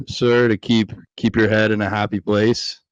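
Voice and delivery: normal talking voice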